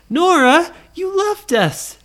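high, loud, indignant whine; mock aggrieved kid voice; complaining brothers.

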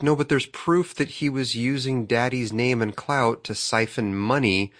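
ordinary talk

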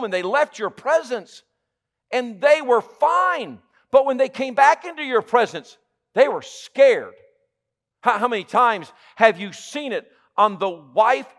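a pastor preaching loud and high to his flock; preaching at full force. Acted. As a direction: preach it hard to the congregation. Preaching to congregation.

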